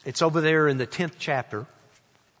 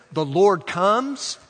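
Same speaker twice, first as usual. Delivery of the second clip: slow, raised proclamation; higher, stretched-out declaration; announcement; 4 semitones higher, 4 dB louder, much slower than usual. Shown as a demonstration. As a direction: announce it slowly and firmly. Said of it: "an announcement".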